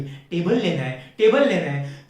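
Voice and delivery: imitated nag, repeating the demand; mocking, insistent repetition; mock repetitive nagging. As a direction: nag like a girlfriend on a loop. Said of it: "दो हफ्ते तक दिमाग चाटेगी".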